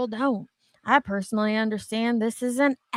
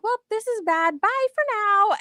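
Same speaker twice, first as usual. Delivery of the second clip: mock sign-off; 11 semitones higher than usual. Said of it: "and was like".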